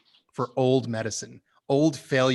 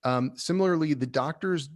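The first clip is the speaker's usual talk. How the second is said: ordinary delivery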